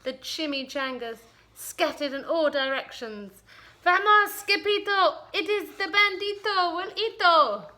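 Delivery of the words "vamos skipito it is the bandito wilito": high, loud cry of alarm; frightened cry. Acted. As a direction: shout the warning in panic. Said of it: "they cried".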